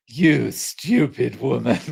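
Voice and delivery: slow, drawn-out comic catchphrase; sitcom catchphrase. Acted. Fan quoting the show's loved line, mock scolding. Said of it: "the thing I loved was".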